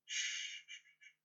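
whispered shh, shh, shh; soft shushing. Acted. Lover calming her anxious girl with whispered shushing. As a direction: hush them gently. Lover hushing near climax.

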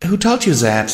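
a touch of tearfulness, voice up; weak question. A narrator's reading. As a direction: ask it feebly through tears. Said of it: "my host asked weakly".

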